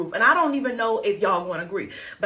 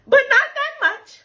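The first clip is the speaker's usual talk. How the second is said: sudden high, loud exasperated cry; exasperated outburst; 6 dB louder than usual. Real, not acted. Tired mom to her clingy kids, exasperated high outburst. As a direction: let it burst out, fed up. Mom overwhelmed by kids' affection.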